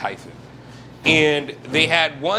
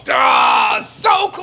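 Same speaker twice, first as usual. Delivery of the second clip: high, loud near-miss yell; excited shout; 10 semitones higher, 5 dB louder, much slower than usual. Real, not acted. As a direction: cry out at a close call. Almost drops garlic, shouts.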